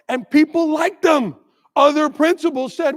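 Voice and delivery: ordinary talk, nothing marked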